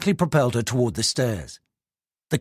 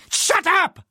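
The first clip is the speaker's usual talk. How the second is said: higher angry shout. Acted. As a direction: cut her off with a yell. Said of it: "he shouted".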